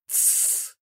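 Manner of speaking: unvoiced hushing hiss; secretive shush. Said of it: "усмехнулась она".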